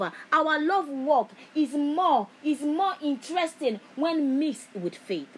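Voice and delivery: loud, slow, drawn-out preaching; loud sermon cry, slowed on the key words; full-force preaching. Acted. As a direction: proclaim it to the whole church. Preaching loud on love and faith.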